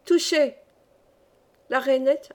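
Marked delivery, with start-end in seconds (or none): 0.0-0.6 higher, short burst of victory; triumphant cry. A narrator's reading. Camus hits the frog with his slingshot, triumphant cry. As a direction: announce a hit in triumph. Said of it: "poussa un cri de triomphe".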